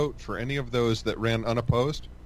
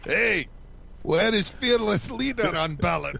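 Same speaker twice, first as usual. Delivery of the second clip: shouted, higher cartoon Russian accent; cartoon spy villain voice; Boris accent; 6 semitones higher than usual. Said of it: "Boris and Natasha".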